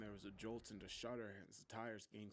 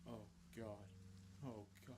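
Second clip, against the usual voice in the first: dread; 4 dB quieter than usual. A narrator's reading. Sees girlfriend's wrecked car.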